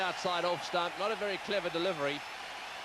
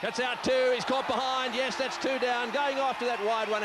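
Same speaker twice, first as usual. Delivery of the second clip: excited shout; 6 semitones higher, 3 dB louder than usual. Real, not acted. Second wicket, commentator shouts.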